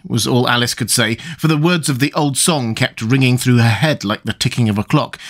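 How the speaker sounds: ordinary delivery, nothing marked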